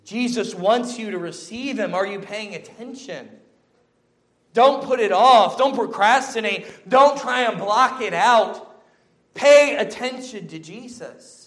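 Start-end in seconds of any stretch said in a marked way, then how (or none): 4.5-11.4 slow, loud pleading exhortation; preaching. Acted. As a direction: urge them, slow and strong. Urgent altar-call exhortation.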